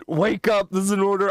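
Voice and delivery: shouted wake-up call; yelling. Pretend. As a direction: yell it out. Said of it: "I just want to see what would happen if I yelled".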